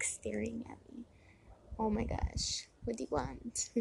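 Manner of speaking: very soft, under-the-breath grumble; hushed aside. Real, not acted. Aside about a lady staring.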